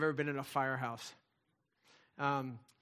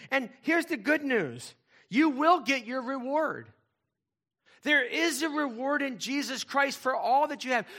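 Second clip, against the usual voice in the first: preaching at full force; 9 semitones higher, 9 dB louder than usual. Acted. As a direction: proclaim the reward to the congregation. Preaching climax on reward.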